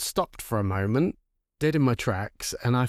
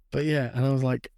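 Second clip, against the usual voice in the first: ordinary talk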